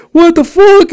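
very high mock-outraged reaction; incredulous. Friend reacts over the phone to the story, incredulous. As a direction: squeak it out in disbelief.

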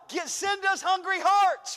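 loud slow cry to heaven; shouted prayer. Real, not acted. Pastor crying out to God for new souls, loud and slow. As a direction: shout the prayer upward, slowly.

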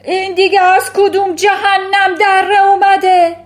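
raging headmistress shout. Acted. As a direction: bellow at the board, shaken. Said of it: "ترانچبول فریاد کشید".

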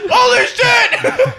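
very high, slow drawn-out yell; high hammed-up holler; mock climax shout. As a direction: stretch it into a comic scream. Pretend climax exclamation, shouted.

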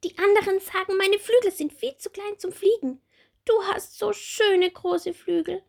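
high, bright little bumblebee; small bumblebee voice. Acted. Little bumblebee Bommel asking Marie about her wings, high childlike voice.

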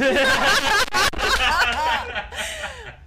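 long high laugh. Real, not acted. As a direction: laugh hard at the story. Laughs at the story.

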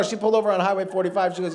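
ordinary talk

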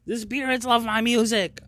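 very high, held falsetto; mock high-pitched voice. Mimicking someone thrilled about spirits, mock high voice. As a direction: put on a high, drawn-out mocking voice.

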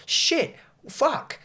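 high, unforced exclamations of amazement; retold disbelief. Viewer recalling each new homage, high-pitched mock astonishment. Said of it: "that I couldn't believe it".

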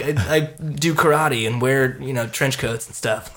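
ordinary delivery

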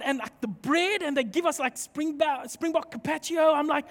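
usual speaking voice

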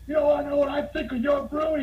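high-pitched mock angry rant; imitated yelling. Bar owner answering his drunk rival, imitated indignant yelling. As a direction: act out an irate bar owner going off. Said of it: "He starts yelling".

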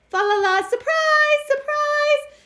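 spring the surprise with a jubilant squeal; gleeful surprise. A narrator's reading. Tim brings tree home to wife.

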